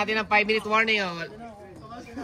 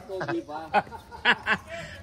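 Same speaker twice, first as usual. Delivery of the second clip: softer trailing laughter; 2 semitones lower, 5 dB quieter than usual. Real, not acted. Commentator still amused courtside, softer laughing. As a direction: keep chuckling, off the commentary.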